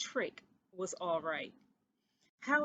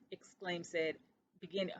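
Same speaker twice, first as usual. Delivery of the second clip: plain reading voice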